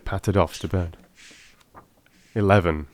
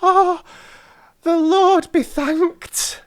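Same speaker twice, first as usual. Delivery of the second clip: high, slow, grateful crone cry; drawn-out thankful exclamation from an old woman; 6 dB louder, much slower than usual. Acted. Old woman thanks heaven for the ring, high and slow. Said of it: "cried the old woman".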